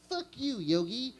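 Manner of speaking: offended little bear; Boo Boo voice. Acted. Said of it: "Boo Boo".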